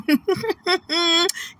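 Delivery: high-pitched cackling laugh; high cackle. Real, not acted. Laughs at tweet.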